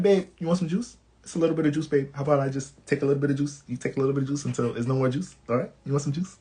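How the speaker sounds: soft, fast, coddling voice; mushy baby talk. Retold or imitated. Kevin Hart fussing over his babe with juice, soft coddling voice. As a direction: coo at her like a doting boyfriend. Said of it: "he's like".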